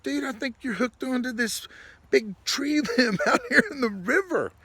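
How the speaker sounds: high voice cracking up mid-sentence; laughing punchline. Retold or imitated. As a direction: imitate your friend's verdict through laughter.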